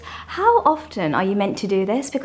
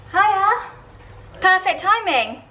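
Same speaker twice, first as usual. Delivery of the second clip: bright greeting; 10 semitones higher, 6 dB louder, slower than usual. Real, not acted. Greeting partner arriving.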